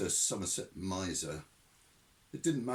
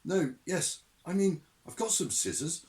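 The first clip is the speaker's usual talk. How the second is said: put-on flustered young man; 9 semitones higher, 5 dB louder than usual. Newton flustered.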